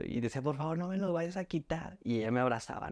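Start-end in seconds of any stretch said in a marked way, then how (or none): 0.4-2.0 higher-pitched imitation of crying and begging; retold tearful plea. Mother begging through tears not to lose her son, mild retold cry. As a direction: replay the pleading through tears, lightly acted. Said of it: "y seguía llorando".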